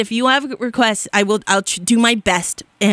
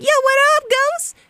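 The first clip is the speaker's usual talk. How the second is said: very high, playful party greeting; mock squeaky kid hailing his ghost pals; Haley Joel Osment. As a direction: pretend child voice, way up high. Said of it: "imagine Haley Joel Osment being like".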